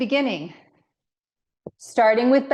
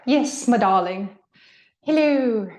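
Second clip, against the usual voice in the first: mock English accent. Fitness coach joking with her class mid-lunge, mock English accent. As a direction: put on a jokey, bad English accent. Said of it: "speak in an English accent".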